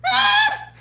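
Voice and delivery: high laughing shriek as the boss; shrill mock panic yelp. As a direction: squeal in fake alarm.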